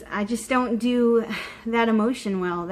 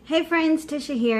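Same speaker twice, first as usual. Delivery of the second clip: perky opener, hey jumps up; bright greeting; 6 semitones higher than usual. Real, not acted. To friends watching the channel, a bright cheerful greeting. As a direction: lift up high on hey for a cheerful vlog hello.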